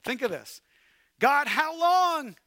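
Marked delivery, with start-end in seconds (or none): 1.2-2.3 loud, high, pleading cry to God; a loud, high lament at heaven; anguished cry. Acted. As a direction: cry out in desperate frustration. Acting out prophet's lament.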